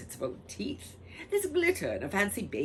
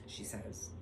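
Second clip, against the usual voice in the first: ordinary delivery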